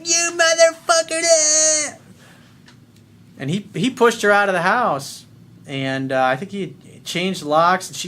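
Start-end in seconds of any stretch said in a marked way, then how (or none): 0.0-2.0 very high, loud, slow screech; furious yell. Retold or imitated. Drunk girlfriend screaming at her boyfriend, very high and furious. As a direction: scream it at him, stretched out.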